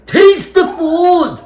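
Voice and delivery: very high, drawn-out mock chef command; exaggerated, badly faked celebrity chef impression; Ramsay Gordon. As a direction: stretch it out like a hammed-up TV chef. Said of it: "I didn't do the Ramsay Gordon thing".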